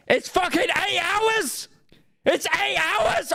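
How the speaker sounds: very high-pitched squealed protest; drawn-out falsetto exclamation of disbelief; incredulous outburst. Real, not acted. Reacting to absurdly long video.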